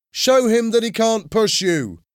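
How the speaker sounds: high-pitched egging on; shouting. A narrator's reading. Boy in the crowd urges Tony to fight back, shouting. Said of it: "one of the boys shouted".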